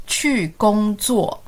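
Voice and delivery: very slow, each syllable held; slow model for repeating. Shown as a demonstration. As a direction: stretch every syllable for learners to copy.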